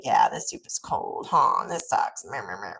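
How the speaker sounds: mock whiny grumble that sinks to a mumble; passive whining. Shown as a demonstration. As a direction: moan along with her halfheartedly. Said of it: "So that would be passive".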